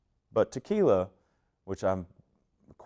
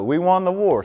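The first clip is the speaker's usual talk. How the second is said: loud teasing boast; 11 semitones higher, 12 dB louder than usual. Real, not acted. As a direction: boast it louder and higher, teasing. Teasing about the war.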